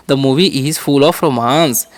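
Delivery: "The movie is full of romance" said slowly, stress on movie; slow model. Shown as a demonstration. Teacher modelling an English sentence slowly. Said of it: "तो कैसे बोलेंगे".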